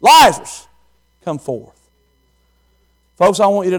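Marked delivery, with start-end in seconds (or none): 0.0-0.7 high, loud call; calling Lazarus. Acted. Jesus at the grave calls the dead man loudly. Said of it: "he stood outside that grave and he said". 1.2-1.7 low, soft, hushed command. Acted. Jesus at Lazarus' grave, a low quiet command. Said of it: "he said".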